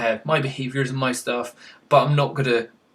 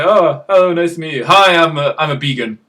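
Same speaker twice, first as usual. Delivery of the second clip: a lightly louder, chirpy pretend greeting; mock introduction; 6 dB louder than usual. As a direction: introduce yourself like a proud stranger. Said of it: "I'm never gonna say that in real life".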